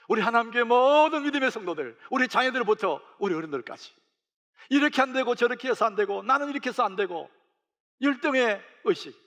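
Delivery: high, loud preacher's climax; rally the congregation with mounting force; loud, driving sermon peak; preaching at full force. Acted.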